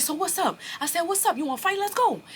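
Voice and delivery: very high, loud dare; loud, high-pitched call to fight; retold fight challenge. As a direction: yell it at him, spoiling for a fight.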